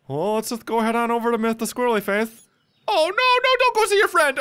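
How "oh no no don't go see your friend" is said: very high, louder falsetto plea; mock falsetto warning. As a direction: squeal the warning in a silly high voice. Mock warning to game character.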